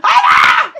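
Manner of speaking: high loud shocked cry; startled shout. Retold or imitated. Retelling being slapped while kissing, sudden loud cry. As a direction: yell as the slap hits. Retold slap mid-kiss.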